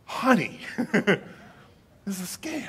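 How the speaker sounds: wife warning her husband, half whispered; knowing wife. Retold or imitated. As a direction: tease gently through a laugh. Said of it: "And my wife's like".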